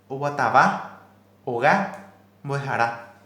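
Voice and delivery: "oguatabá, oga, boejará" with a loud, punched final syllable; wrong final-syllable stress, said loud; stress on last vowel. Shown as a demonstration. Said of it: "se estaría cargando la voz en la última vocal".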